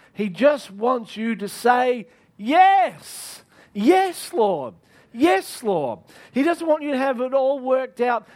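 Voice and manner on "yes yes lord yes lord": high, loud, eager cry of yes; fervent yes. Pretend. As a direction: cry out yes with all your heart. Preacher voicing an eager yes.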